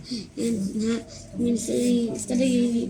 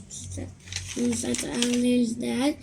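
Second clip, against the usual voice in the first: plain reading voice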